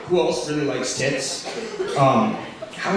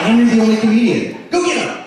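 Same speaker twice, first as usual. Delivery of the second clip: mock hype; 7 semitones higher, 5 dB louder than usual. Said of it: "they're like".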